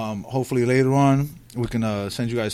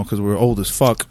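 normal talking voice

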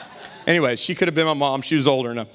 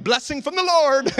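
mock pious rejoicing; 10 semitones higher than usual. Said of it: "most people would be like".

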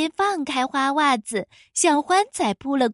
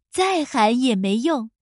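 ordinary delivery, nothing marked

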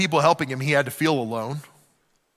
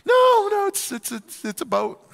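much higher, stammering and awkward; flustered Noah. Retold or imitated. Noah admitting to the neighbor it's a boat, flustered. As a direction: caught out, a flustered squeak.

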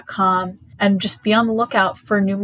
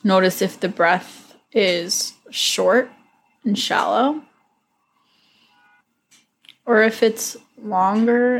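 guided meditation. Acted. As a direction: ease listeners gently into calm.

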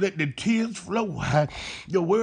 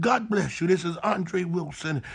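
normal talking voice

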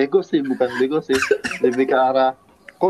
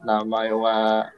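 mock Japanese; 3 semitones lower than usual.

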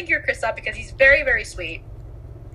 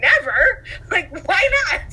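mock dismayed prince; 7 semitones higher, 4 dB louder than usual.

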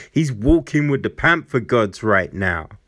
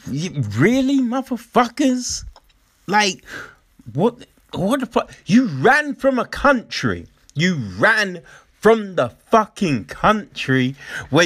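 exasperated retort; 7 semitones higher than usual. Retold or imitated. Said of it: "I'm just like".

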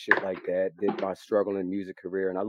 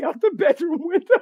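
laughing; 8 dB louder than usual. Real, not acted. Laughing at memory.

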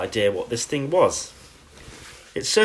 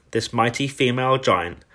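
plain reading voice

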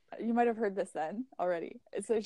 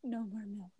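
soft retold whisper; 8 dB quieter than usual. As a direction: hush it like a secret. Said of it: "So I whispered".

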